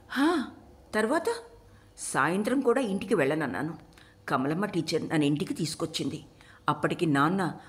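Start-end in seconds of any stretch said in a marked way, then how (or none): 0.1-1.2 lightly voiced girl, pitched higher; prompting listener. A narrator's reading. Listener eagerly prompts the storyteller.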